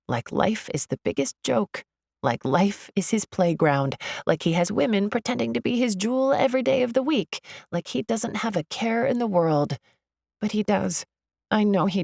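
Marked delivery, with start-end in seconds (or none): none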